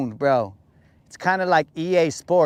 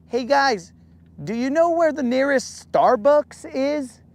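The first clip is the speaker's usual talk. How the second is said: naive tourist; 8 semitones higher than usual. Retold or imitated. Clueless tourist asking directions.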